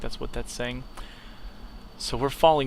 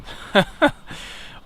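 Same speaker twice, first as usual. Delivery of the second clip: laugh; 10 semitones higher, 6 dB louder than usual. Real, not acted. Amused at the zoom view.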